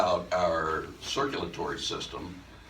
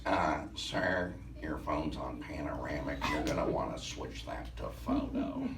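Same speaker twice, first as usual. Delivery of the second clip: put-on hushed, polite young woman; imitating her gentle correction; soft young helper; 4 semitones lower, 6 dB quieter than usual. He mimics the girl quietly correcting his phone.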